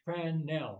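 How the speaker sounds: Crannell drawn out slowly as CRAN-NELL, both syllables full; careful pronunciation. Shown as a demonstration. Resident correcting the presenter on the street name, Crannell slow and distinct. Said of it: "Is it Crannell or Crannell?".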